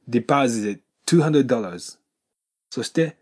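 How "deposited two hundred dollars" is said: slow, "deposited two" merged into one stop; linked d and t. Shown as a demonstration. Teacher demonstrating the linking, slowed down. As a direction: slowly blend the d of "deposited" into "two". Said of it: "これがくっついて".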